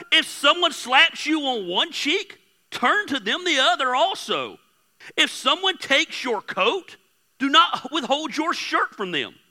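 solemn Bible reading; scripture reading. Acted. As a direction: read the verses out like from the pulpit. Reads scripture aloud.

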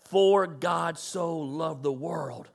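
low, soft, slow over music; hushed verse. Real, not acted. Pastor quoting the verse over music, soft and low. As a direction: drop to a soft low hush.

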